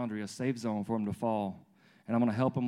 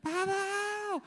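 put-on screaming toddler. Dad mimics his daughter as waffle disappears, screaming toddler. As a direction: imitate a little girl's upset squeal. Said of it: "she starts screaming all over again".

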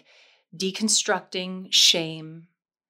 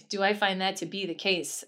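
ordinary delivery

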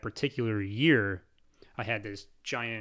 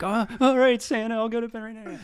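put-on much higher, faster, eager little boy; eager kid; 12 semitones higher, 7 dB louder than usual. Boy promising Santa on the phone to go to bed, much higher and eager. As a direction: rush it out like an obedient thrilled kid. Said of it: "I'm like".

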